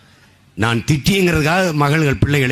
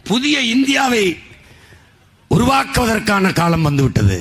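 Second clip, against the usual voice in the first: Narendra Modi; 6 semitones higher than usual. Retold or imitated. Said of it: "ஐயா நரேந்திர மோடி".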